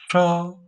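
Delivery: "cho" high, level and loud; first tone. Shown as a demonstration. Teacher demonstrating first tone, "cho" high and level. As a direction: hold "cho" up on a steady high note. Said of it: "it has the first tone".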